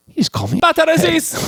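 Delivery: high-pitched, awed outburst; hammed-up astonished cry; elated disbelief. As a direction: blurt it high with amazed delight. Acting out Billy's astonished reaction.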